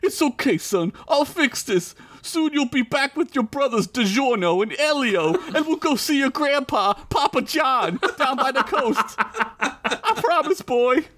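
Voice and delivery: high, strained reassuring father; through gritted teeth. Acted. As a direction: comfort your son through the pain. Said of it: "through gritted, bloody teeth".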